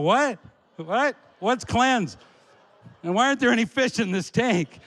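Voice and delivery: high, loud incredulous questions; mock-panicked confusion; bewildered alarm. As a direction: demand answers, pitched high in disbelief. Acted bewildered questions to the therapist.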